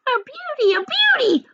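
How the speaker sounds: high, excited aunt crying out. Acted. Aunt Sponge exclaiming at the peach, high and eager. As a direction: gush over the fruit, high and greedy. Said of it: "Aunt Sponge cried out".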